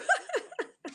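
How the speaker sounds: a burst of high, amused laughter; giggling at her sister; high giggle. Real, not acted. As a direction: laugh up high, caught off guard.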